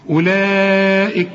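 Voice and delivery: اولئك with the middle vowel stretched very long; four-count madd. Shown as a demonstration. Teacher demonstrates obligatory madd, stretching اولئك long. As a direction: hold the "laa" of اولئك before the hamza. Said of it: "مد هنا اربع حركات".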